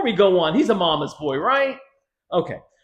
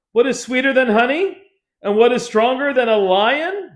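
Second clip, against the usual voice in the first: louder, triumphant reply; mock-triumphant townsmen; smug answer; 7 semitones higher, 7 dB louder than usual. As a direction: announce it smugly. Men of city answering riddle.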